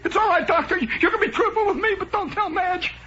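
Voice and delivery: very high, whimpering falsetto; pleading patient. Acted. Patient begging doctor not to tell Madge, mock tearful pleading. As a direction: beg in hammed-up anguish. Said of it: "And the patient says".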